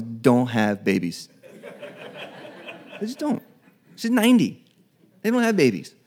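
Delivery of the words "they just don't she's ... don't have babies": much higher, driving home the absurdity; disbelieving, pitched way up; incredulous emphasis. Real, not acted. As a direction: sound amazed at the very idea. Preacher stresses Sarah's age.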